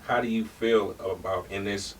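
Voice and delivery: normal talking voice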